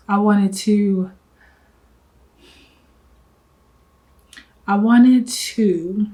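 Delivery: halting, slow words as feelings rise; pausing to hold back tears; getting emotional. Real, not acted. Telling viewers why she started her business, choking up. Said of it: "I am getting emotional".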